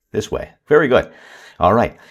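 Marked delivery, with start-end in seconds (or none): 0.7-1.1 praise. Shown as a demonstration. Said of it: "praise the child".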